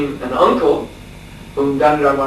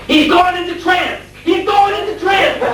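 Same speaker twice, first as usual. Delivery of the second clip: slow, very high-pitched mock alarm cry; imitated shout; 4 dB louder than usual. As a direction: shout it like sounding a hammed-up alarm. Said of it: "he would shout".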